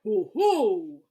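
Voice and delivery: a monster gloating over its catch; Heffalump's Ho ho. Acted. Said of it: "then he'll say".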